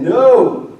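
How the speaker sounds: sudden high, loud no; firm no. Acted. Elizabeth refuses the relatives with a loud high no. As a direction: cut them off with a sharp no.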